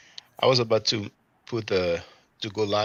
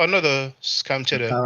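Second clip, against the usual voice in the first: put-on joking French accent, a bit higher and louder; 4 semitones higher, 4 dB louder than usual. Hesitant first go at reading a comment, French accent. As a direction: play it up in a fake French accent for fun. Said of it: "I was about to speak like this with a French accent".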